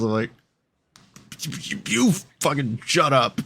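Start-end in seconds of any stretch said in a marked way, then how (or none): 1.6-3.4 higher, mildly heated snap-back; half-hearted mock telling off; mock angry retort. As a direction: shut the kid up, hammed-up. Snapping back at mouthy kids.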